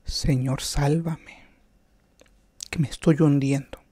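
a quiet desperate prayer; a hint of a sinking man crying out; pleading prayer. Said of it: "el Papa grita a Dios".

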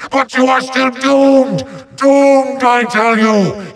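hammy, high-pitched menace; bombastic threat. Acted. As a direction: cry out doom at the hero. Villain threatens the hero.

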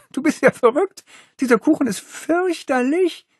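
put-on high, disgusted girl's voice; scornful girl. Holly scoffing at Miles for liking the cake. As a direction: sneer at the cake.

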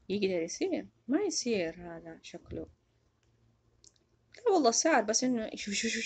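ordinary talk

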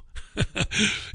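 soft, breath-only chuckle; amused chuckle. Real, not acted. Radio host amused at his own aside, soft chuckle. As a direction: laugh quietly to yourself.

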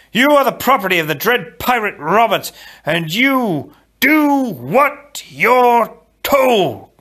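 loud, high, slowing to drawn-out words; roared command. A narrator's reading. Wesley orders Buttercup to obey, roaring imperiously. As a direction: thunder the order, stretching the final words. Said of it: "Wesley roared".

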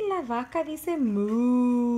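loud, slow, drawn-out moo; mock cow moo. Mooing for baby.

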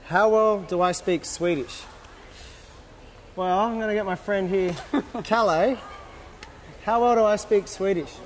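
normal talking voice

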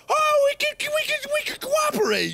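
silly high-pitched pretend enthusiasm; mock eagerness. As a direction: play a fake-friendly settler in a squeal. Acted settlers pretending to cooperate.

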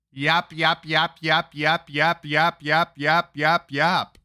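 rapid-fire string of yaps in an even rhythm; mock yapping. Mocking Shelby for talking without proof, mock yapping. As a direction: imitate endless chatter as repeated yaps. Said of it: "You're yapping".

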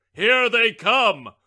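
loud, slow, thrilled call; excited cry. A narrator's reading. Wishbone crying out as the children romp in, loud and thrilled. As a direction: announce them like a grand arrival. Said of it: "cried the wishbone".

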